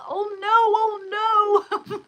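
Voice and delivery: high, slow, stretched "oh no"; mock despair; dismayed wail. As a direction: relive the horror of the dye job. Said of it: "I was like".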